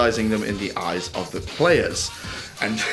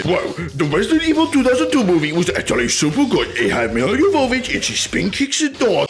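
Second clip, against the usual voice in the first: higher, excitable mock fan voice; mock commenter; 7 semitones higher than usual. YouTuber imitating protesting commenters, mock-excited fan voice. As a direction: gush like an indignant fanboy. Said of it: "in the comments with your".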